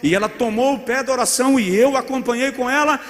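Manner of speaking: rising excitement. Acted. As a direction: let the story climb with excitement. Story climax, voice rising.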